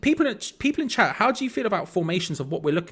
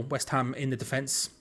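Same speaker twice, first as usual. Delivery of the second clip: ordinary talk, nothing marked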